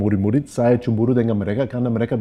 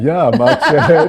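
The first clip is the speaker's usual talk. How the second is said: laughing; 6 semitones higher, 9 dB louder, much slower than usual. Real, not acted. Laughing about Jakarta traffic.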